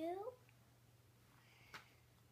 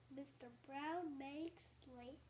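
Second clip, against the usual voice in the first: usual speaking voice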